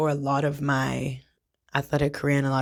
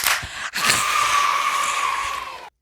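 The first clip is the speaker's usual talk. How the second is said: loud sigh; 6 dB louder than usual. Real, not acted. Overwhelmed by the good news.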